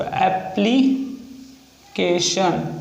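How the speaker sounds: extremely slowed dictation; very slow stretched word. Shown as a demonstration. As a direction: pull the word out syllable by syllable. Repeats word while writing.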